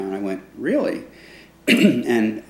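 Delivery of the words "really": high startled "Really?"; surprised. Retold or imitated. To roommate, disbelieving news of the band: surprised. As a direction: jump up in pitch, caught off guard.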